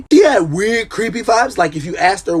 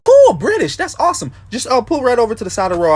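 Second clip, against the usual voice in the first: excited cop; 5 semitones higher than usual. Retold or imitated. Cop thrilled to meet a British driver, high and eager. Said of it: "sorry for the British accent".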